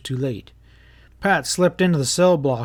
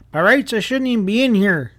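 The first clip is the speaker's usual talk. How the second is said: indignant protest; 8 semitones higher, 5 dB louder than usual. Acted. Prisoner protests his innocence.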